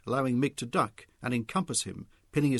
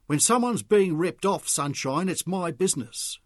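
loud, higher confrontation; firm confrontation; 6 semitones higher, 6 dB louder than usual. A narrator's reading. Mick confronting Charlie, loud and firm. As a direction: stand your ground.

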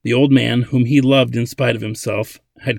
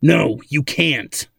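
slow, firm, angry refusal; 4 semitones higher, much slower than usual. A narrator's reading. Old man refuses Ladin, angry. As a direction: shut him down, each word drawn out. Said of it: "Simic replied angrily".

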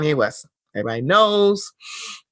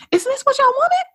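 high, near-breaking rising question; exasperated disbelief. Real, not acted. Asking critics what they wanted, exasperated. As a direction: plead it, baffled.

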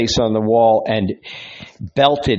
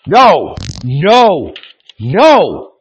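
high, loud desperate no; loud refusal; 6 semitones higher, 7 dB louder, much slower than usual. Acted. Man refusing the voice from above, loud and desperate. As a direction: cry out no to the voice above. Shakes head violently, refusing voice.

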